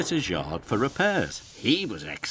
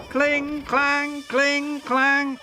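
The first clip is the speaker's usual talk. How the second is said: giggling trucks; 5 dB louder, much slower than usual. A narrator's reading. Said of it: "they giggled".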